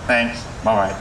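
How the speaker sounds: ordinary talk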